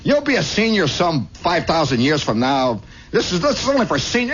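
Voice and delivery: high, scornful big-brother put-down; acted kid's bragging retort; bratty kid. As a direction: sneer at your little brother. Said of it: "I said".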